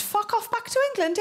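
high, mock snarl of a village local; mock hostile local. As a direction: spit it like a grumpy villager. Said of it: "but it's pronounced".

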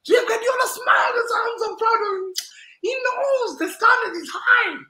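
gleeful high-pitched brag; excited boast. Real, not acted. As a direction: crow about it.

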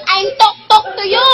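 ordinary talk

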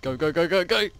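loud, eager go go go; excited urging. Real, not acted. Player rushing friends out, loud excited urging. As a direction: urge everyone on excitedly.

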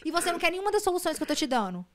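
high-pitched, exasperated argument; exasperated retort. Retold or imitated. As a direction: argue back at someone who rejects every solution. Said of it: "Eu falei assim". (desabafo exasperado, em voz aguda)

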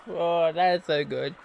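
ordinary talk

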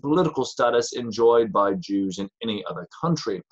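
reading an official letter aloud, measured and formal; formal reading of an old letter; formal document reading. Acted. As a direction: read it like a state declaration. Reads out the declaration text.

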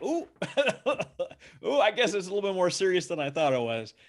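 mock-shocked sailor, giggling; laughing young sailor. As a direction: play the startled recruit with a laugh. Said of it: "and they're like".

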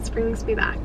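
soft nostalgic whisper. Real, not acted. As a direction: whisper it to yourself. Nostalgic aside while sipping.

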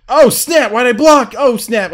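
high, loud yelps of alarm; alarmed outburst. Real, not acted. Player caught by a mistake mid-match, alarmed outburst.

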